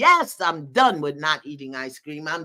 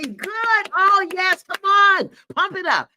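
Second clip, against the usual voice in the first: high, loud cheering; hype cheering; 12 semitones higher, 7 dB louder, slower than usual. Real, not acted. Host hyping the chat's declarations, high excited cheering. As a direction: pump up the crowd.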